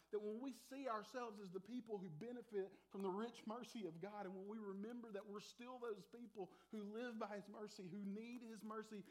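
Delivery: normal talking voice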